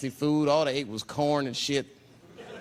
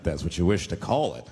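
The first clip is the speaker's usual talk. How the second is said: deadpan Native elder; 11 semitones lower than usual. Retold or imitated. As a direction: drop into a low, solemn elder's voice. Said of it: "He said".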